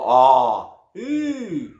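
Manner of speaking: warm up the voice. Shown as a demonstration. Coach showing a vocal warm-up, ah then ee. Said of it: "So go".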